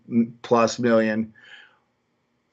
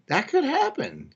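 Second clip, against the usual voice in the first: high pitch jump; 13 semitones higher than usual. Real, not acted. Repeating chat comment aloud.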